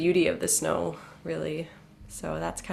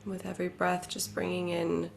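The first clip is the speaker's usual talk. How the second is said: guided meditation; 8 dB quieter than usual. Real, not acted. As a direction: settle listeners inward with a quiet, unhurried delivery. Leads a meditation.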